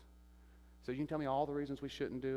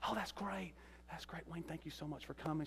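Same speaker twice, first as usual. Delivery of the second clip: high grateful mother; 6 semitones higher, 5 dB quieter than usual. Retold or imitated. Mother thanks him.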